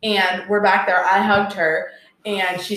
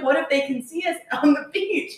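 higher, hushed anxious question; worried panic; 8 semitones higher than usual. Retold or imitated. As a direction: friends fretting while hiding. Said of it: "they're like".

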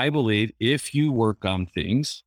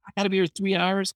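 high, fast, exasperated protest; irritated complaint; 10 semitones higher, faster than usual. Retold or imitated. Teen boy to his new therapist, mock exasperated. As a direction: whine it like a teen dragged there against his will. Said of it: "he was a little irritated".

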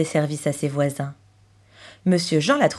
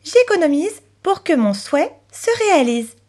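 put-on higher-pitched, singsong child; lightly voiced little girl; 7 semitones higher than usual. Chloé receiving pocket money, reciting hopefully.

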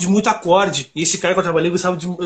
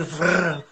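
quick "vrr" of a part tearing loose; rolled sound effect for something ripping away; imitated ripping noise; 2 semitones lower, 5 dB quieter than usual. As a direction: mimic a guitar bridge popping off with a vrr. Imitates guitar bridge ripping off.

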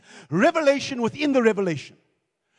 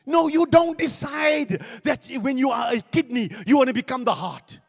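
preaching at full force. Real, not acted. Pastor calling the church for a clap, shouting. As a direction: drive it home to the congregation.

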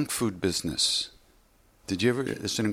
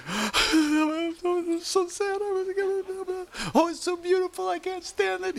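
mock sobbing. Mock weepy moviegoer.

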